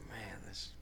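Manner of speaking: breathy whisper of dismay; whispered inner thought. Retold or imitated. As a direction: whisper it, lost for words. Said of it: "I was like".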